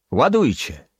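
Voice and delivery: shouted order. A narrator's reading. Greek shouting to the bosun to load, loud. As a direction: bark the order across the deck. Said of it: "krzyknął".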